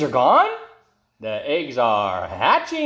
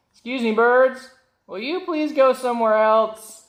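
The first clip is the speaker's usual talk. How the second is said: polite elephant; 9 semitones higher than usual. Acted. Elephant politely asks birds.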